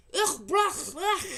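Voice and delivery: loud, high retching disgust; disgusted roaring. A narrator's reading. Giant tasting the snozcumber, retching loudly. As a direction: gag and roar it out. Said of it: "roared the blood bottler".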